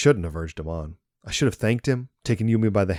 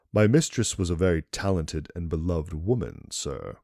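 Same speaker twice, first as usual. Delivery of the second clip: ordinary delivery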